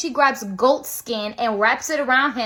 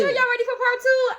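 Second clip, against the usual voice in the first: high-pitched excited call; playful hype; 10 semitones higher than usual. Acted. Storyteller to viewers before part two, playful hype.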